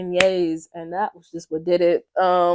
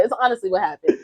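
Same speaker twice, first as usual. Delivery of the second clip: ordinary delivery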